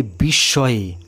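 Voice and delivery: usual speaking voice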